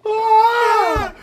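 very high loud mock shriek. Friend retelling a buddy's tumble, mock shriek. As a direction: shriek it out in imitation. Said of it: "and then he shrieks".

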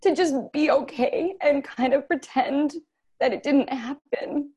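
high, tearful strain while holding it together; choked up, pushing through it with pauses; getting emotional. Real, not acted. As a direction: voice lifted high with real emotion. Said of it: "I'm gonna get emotional".

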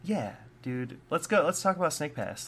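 ordinary talk

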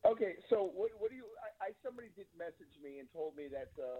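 faint voice from a distant phone; speaking away from the phone; phone held away. Real, not acted. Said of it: "You have to speak into the phone".

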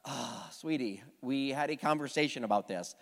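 low, quiet, weary patience; mildly annoyed. Retold or imitated. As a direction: imitate a wife holding back annoyance. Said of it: "like, annoyed almost".

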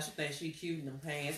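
usual speaking voice

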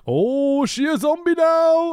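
high, slow, baffled "she a zombie now"; mock surprise. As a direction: pretend shock at the twist. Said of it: "And I'm like".

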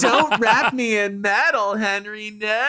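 high, slow hammy plea; mock high pleading. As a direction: overplay the begging. Mocking the coworker's plea.

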